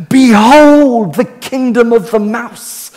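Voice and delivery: high, slow, awestruck proclamation; mock grand announcement. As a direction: announce it like a wonder-struck herald. Voicing the kids' awe.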